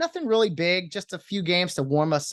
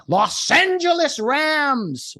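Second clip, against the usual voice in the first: slow, booming stadium announcer call; announcer intro; 8 semitones higher, 6 dB louder, much slower than usual. Pretend. Fan announcing his champs over the PA, slow and loud. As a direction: drag out the team name like a PA announcer.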